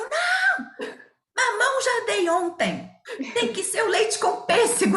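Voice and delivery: sharp high protest, almost a shriek; retold frantic no. Nutritionist mother correcting her husband, high and frantic. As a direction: stop him before he repeats the fruit.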